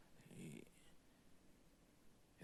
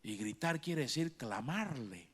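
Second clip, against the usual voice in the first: ordinary talk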